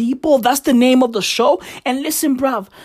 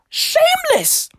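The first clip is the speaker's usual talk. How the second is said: drawn-out high punchline. Acted. Exasperated punchline.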